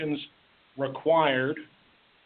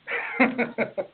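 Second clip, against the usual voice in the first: short breathy laugh; 8 semitones higher than usual. Real, not acted. Laugh after busily writing remark.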